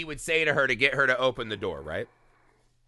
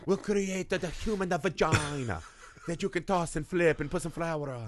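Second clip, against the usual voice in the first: put-on cartoon Italian accent; 3 semitones higher, 4 dB quieter than usual. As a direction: speak as a mock Italian schemer. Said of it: "doing this Italian accent".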